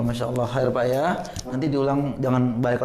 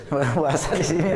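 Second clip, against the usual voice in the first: laughing; faster than usual. Real, not acted. Teasing student about breaking fast.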